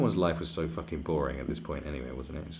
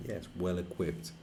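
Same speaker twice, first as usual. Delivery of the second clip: ordinary talk, nothing marked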